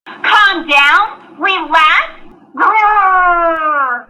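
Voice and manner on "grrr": loud frustrated growl; angry growl. Acted. [name] growls after calm down.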